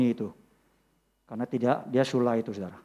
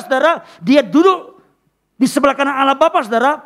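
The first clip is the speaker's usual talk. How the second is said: high, loud proclaiming; preaching at full force; 10 semitones higher, 8 dB louder than usual. Acted. Preacher proclaiming Christ's victory to the congregation, loud and high. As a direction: preach it out to the church.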